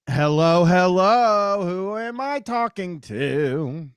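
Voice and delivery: high, loud, stretched-out hello; drawn-out phone greeting. Real, not acted. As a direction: call out to the caller, drawing it out. Host calls out to next caller.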